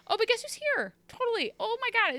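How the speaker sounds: high, mock-excited gushing. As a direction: squeal it up high like fake delight. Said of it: "she's just like".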